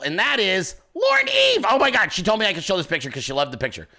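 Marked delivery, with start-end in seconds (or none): none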